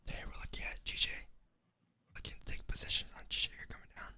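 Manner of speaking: soft unvoiced ASMR whisper. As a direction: whisper it close to the mic like ASMR. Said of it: "You guys want A S M R?".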